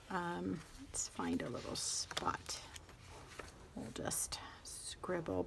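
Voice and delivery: murmured under her breath, slowed down; a hushed aside to herself while testing the pen; whispered aside. Real, not acted. She whispers to herself as she finds a spot to scribble.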